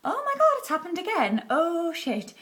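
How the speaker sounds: high, alarmed cry of dismay; a high panicked gasp of disbelief; panicked exclaim. Retold or imitated. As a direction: blurt it out in shock. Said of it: "actually saying out loud".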